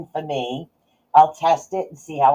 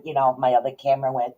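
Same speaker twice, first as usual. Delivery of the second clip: ordinary delivery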